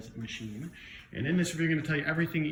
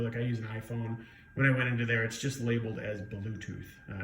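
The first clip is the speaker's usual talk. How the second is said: ordinary talk, nothing marked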